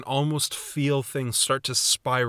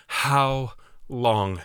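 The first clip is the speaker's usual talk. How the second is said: anguished cry. Retold or imitated. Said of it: "You can just hear the emotion in his voice".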